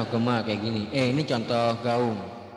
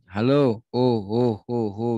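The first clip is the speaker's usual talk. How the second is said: echo demo; 3 dB louder, slower than usual. Shown as a demonstration. Teacher demonstrating echo to students, trailing echo. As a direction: let the echo trail after. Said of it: "bunyinya menyusul".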